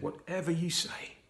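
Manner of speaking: soft whispered surrender, fading out. A narrator's reading. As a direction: let the words sink to a breath. Jesus whispers his surrender.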